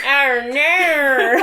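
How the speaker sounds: a laughing, hammed-up Australian "Arr, ner"; bad Australian accent. Co-host mocks the actor's lost accent in a laughing bad Australian accent. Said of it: "That's my best attempt at an Australian accent".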